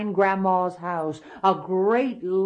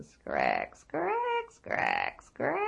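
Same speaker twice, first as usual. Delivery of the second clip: rocking chair creak; 11 semitones higher, much slower than usual. A narrator's reading. Storyteller sounds Grandma's chair, swooping up and down. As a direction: creak it like an old rocker.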